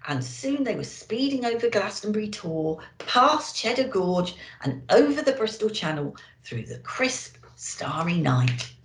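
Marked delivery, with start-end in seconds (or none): none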